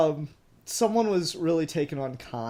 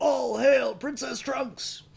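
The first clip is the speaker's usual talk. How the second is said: slow, high mock hail; drawn-out ceremonial hail, half joking; mock proclamation; 10 semitones higher, slower than usual. Host answering with a slow mock royal hail. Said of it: "joining the quote bit".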